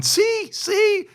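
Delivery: very high, loud proving it; triumphant sergeant. Retold or imitated. Sergeant jumping on the mine, very high loud triumph. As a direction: show them it's harmless, shrill and loud.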